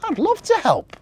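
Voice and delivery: high, bright-voiced elf, keen; eager little elf. A narrator's reading. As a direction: say yes like a thrilled elf. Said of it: "replied Marzi".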